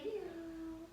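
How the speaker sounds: high little meow like a cat. Shown as a demonstration. Teacher shows the children a cat sound, high meow. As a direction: meow for the kids like a kitten. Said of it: "Good cat noise".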